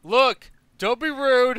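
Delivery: high, loud, slow indignant protest; mock offended. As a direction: play up being hurt and huffy. GM mock-offended at pig remark.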